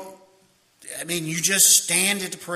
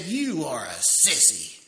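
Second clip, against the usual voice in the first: sneering insult; 4 semitones lower, slower than usual. Retold or imitated. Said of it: "He said".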